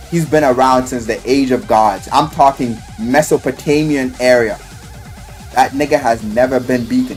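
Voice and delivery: ordinary talk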